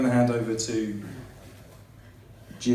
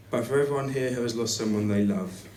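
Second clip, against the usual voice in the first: ordinary talk, nothing marked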